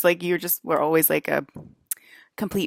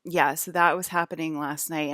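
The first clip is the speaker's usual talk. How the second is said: usual speaking voice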